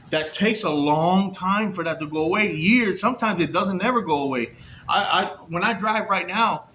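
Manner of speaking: usual speaking voice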